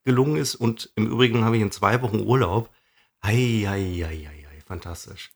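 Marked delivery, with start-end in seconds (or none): 3.2-5.3 mock-serene murmur; soft relaxed voice. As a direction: lower and much softer, blissfully relaxed. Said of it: "Deine Stimme wird auch noch so weich dabei".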